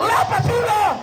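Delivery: exasperated high-pitched order; komandan jengkel. Acted. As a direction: lose patience and yell the order high. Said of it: "Akhirnya komandan jengkel". (perintah jengkel, nada tinggi)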